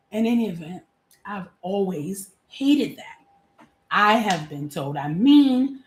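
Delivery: ordinary talk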